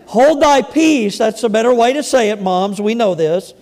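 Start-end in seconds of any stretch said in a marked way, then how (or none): 0.1-1.2 slow, higher scripture command; slow and raised, a measured rebuke; emphatic reading. Acted. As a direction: draw it out with weight. Rereads it as the better wording.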